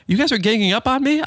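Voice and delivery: high-pitched, incredulous retold question; mock indignant. Husband to teasing wife and cleaner, mock indignant. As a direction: act out pretend hurt at being ganged up on. Retold protest at being teased.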